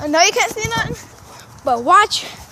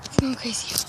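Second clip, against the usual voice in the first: ordinary talk, nothing marked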